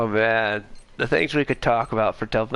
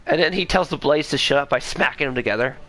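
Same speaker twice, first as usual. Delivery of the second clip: ordinary talk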